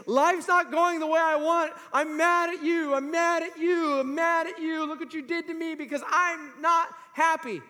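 high, loud acted-out whining complaint; self-pity tantrum. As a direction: play self-pity lashing out, drawn-out and accusing. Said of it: "Self-pity said".

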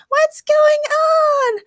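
very high, loud, very slow demand; shrill demand. A narrator's reading. Morgana demanding answers, overwhelmed. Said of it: "Morgana demanded".

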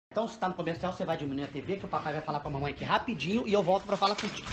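put-on low, soft talk to a child. Aside to child before phone call. (falando baixinho e mais grave com o filho)

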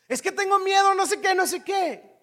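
high, whiny scared voice; mimicking fearful person. Counselee mimicked as high and anxious. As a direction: pitch it way up like a worried kid.

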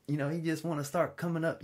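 ordinary delivery

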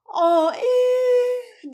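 a loud crying sigh, donkey grief; loud sad wail. Acted. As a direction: wail it out, heartbroken. Sad donkey sighs aloud. (yüksek sesle ağlamaklı inilti)

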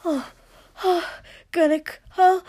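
high, loud broken cries; breaking, urgent outcry; sharp breathless cries. Acted. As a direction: gasp the words out in rising bursts. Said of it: "Shoto hissed sharply and suddenly".

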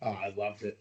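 ordinary talk

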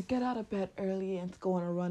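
murmured inner prompt. Retold or imitated. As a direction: say it like a quiet nagging thought. Inner voice quoted.

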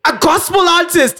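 high, laughing cry of disbelief. Real, not acted. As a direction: blurt it out while cracking up.

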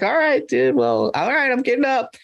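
put-on high, slurry apologetic drunk; drunk guy voice. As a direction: play a sloppy drunk caught trespassing, high. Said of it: "He's like".

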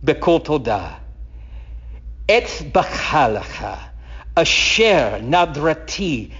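slow, deliberate Hebrew, each phrase set apart by pauses; slow Hebrew reading. Shown as a demonstration. Teacher to congregation, reading the Hebrew slowly. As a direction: read it slowly so they catch the name. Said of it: "I'm gonna read it very slowly".

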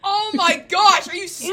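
high, loud, drawn-out disbelief; loud incredulous outburst; exasperated shout. Real, not acted. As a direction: scold at full volume, slowly. Scolding friend in disbelief.

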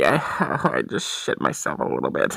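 ordinary talk

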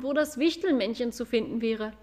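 ordinary delivery

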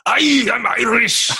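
hammed-up Irish accent; fake Irish accent. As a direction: drunk comic hamming an Irish brogue. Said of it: "he goes".

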